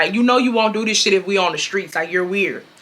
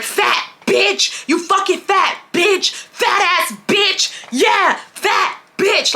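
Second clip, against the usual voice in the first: much higher, slow mocking insult voice; mocking rude customer; 9 semitones higher, much slower than usual. Mocking the customer who kept cursing her, high and slow. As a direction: mimic her nasty sneer, stretched out.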